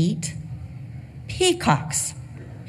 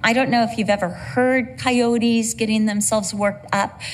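ordinary talk, nothing marked

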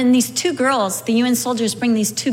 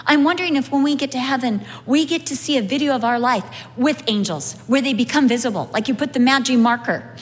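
ordinary talk

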